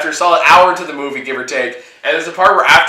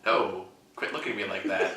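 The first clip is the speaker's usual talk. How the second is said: much softer laughing aside; quoting Chris; 7 semitones lower, 14 dB quieter than usual. Retold or imitated. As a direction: mutter it low while laughing. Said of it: "Chris was like".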